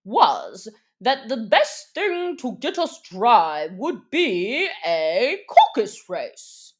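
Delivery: a hint of a pompous dodo; slow, measured bird voice; lightly voiced dodo explaining. A narrator's reading.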